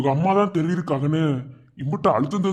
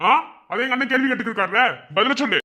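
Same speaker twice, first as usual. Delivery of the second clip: angry scolding; 7 semitones higher than usual. Acted. Husband's brother scolds the wife.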